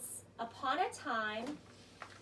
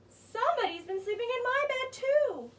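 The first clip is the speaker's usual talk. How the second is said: Mama Bear; 8 semitones higher, 4 dB louder than usual. Acted. Mama Bear's turn.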